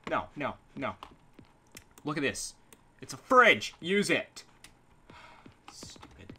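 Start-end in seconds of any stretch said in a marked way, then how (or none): 5.7-6.3 breathy whisper under his breath; muttered insult aside. Real, not acted. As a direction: hiss it to yourself.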